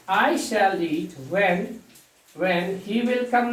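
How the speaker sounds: very slow, word by word; teacher spelling out a sentence slowly; slow dictation. Shown as a demonstration. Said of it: "ना हो के क्या होगा".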